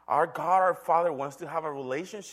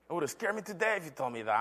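ordinary talk

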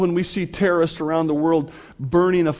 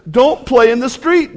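raised, louder parental warning; 7 semitones higher, 7 dB louder than usual. Acted. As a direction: call out firmly like a parent to a child. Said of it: "It's like the parent who says".

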